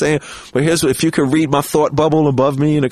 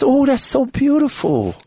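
high, slow admiring voice; gently marvel, pitched up; high slow Michael impression; 9 semitones higher than usual. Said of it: "He says".

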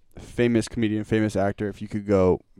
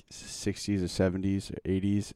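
ordinary talk

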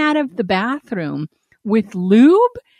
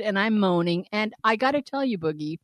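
usual speaking voice

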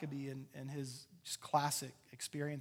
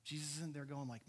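usual speaking voice

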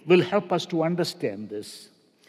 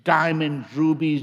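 ordinary talk

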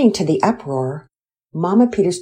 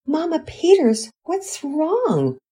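put-on higher, concerned child asking what's wrong; worried child voice; 11 semitones higher, slower than usual. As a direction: call down to her, worried. Family rushes to fallen mother.

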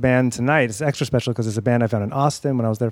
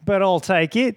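higher-pitched, put-on Australian accent; attempted Australian accent; 7 semitones higher than usual. Guest put on the spot, badly faked Australian accent. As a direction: have a quick go at an Australian accent. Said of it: "That's a very good Australian accent".

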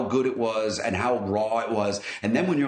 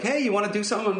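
host voice; 10 semitones higher, faster than usual. Retold or imitated. Host asking, quoted in high voice.

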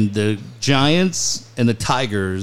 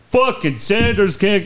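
retold yelling; 7 semitones higher than usual. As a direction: bark it like an angry manager, half-playful. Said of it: "two guys that are yelling at each other".

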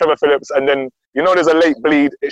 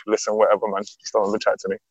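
dismissive mutter; 5 semitones lower, 6 dB quieter than usual. Retold or imitated. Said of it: "I was just like".

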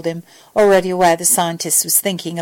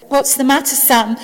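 put-on mildly nervous child's question, higher pitched; 6 semitones higher than usual. A narrator's reading. Little girl asks Sam what is wrong, mildly nervous. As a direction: ask with a touch of nerves, voice lifted. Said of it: "she asked nervously".

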